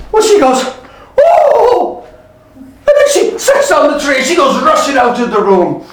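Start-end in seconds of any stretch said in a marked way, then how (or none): 1.2-2.0 high-pitched acted "oh" of shock; shocked cry. Cook startled by Robin, high shocked cry.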